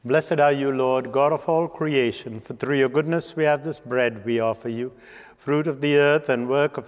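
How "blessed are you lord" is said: normal talking voice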